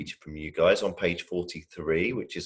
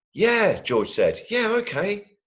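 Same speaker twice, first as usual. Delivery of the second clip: put-on eager child yes; 12 semitones higher, 4 dB louder, slower than usual. Little brother voiced.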